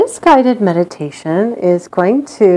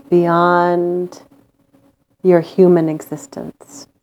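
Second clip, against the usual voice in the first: guided meditation. Acted. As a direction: lull them into calm with long pauses.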